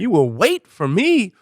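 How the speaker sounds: high, slow, imperious demand; indignant moose. Retold or imitated. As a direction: voice an entitled animal laying down the law. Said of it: "And she's like".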